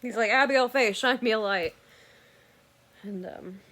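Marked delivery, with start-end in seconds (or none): 0.3-1.8 loud call using her first and middle names; husband calling out to distracted wife, loud and firm; sharp full-name call. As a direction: snap her attention back, loud. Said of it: "call her by main name and middle name".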